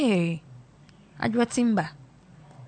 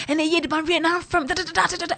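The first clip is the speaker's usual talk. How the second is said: high, fast mocking husband voice; 8 semitones higher, faster than usual. Husband rattling on to his wife on the phone, mocked high and fast. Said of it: "the husband was like".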